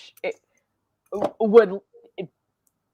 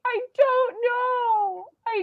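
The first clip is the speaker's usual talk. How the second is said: exasperated wail; slower than usual. Real, not acted. Exasperated at clip.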